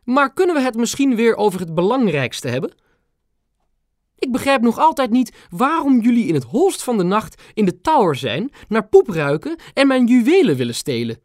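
high, clipped royal voice; a queen's crisp staccato interruption. A narrator's reading. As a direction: bite off each word like a stern queen. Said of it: "met haar staccato klinkende stem".

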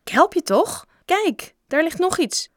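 high, loud defensive protest; indignant protest. A narrator's reading. Bob defending himself to Joe over the cleanup, indignant. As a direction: protest your innocence, pitched right up.

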